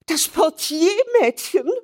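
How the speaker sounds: very high, loud horrified shriek; shocked shriek. Acted. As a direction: cry out as if in pain. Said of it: "Die Gräfin schrie auf". (entsetzter Aufschrei)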